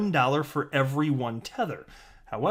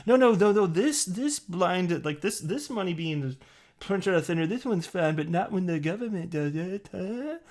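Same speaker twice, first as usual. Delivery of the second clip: mocking Bitcoin maxis; 5 semitones higher than usual.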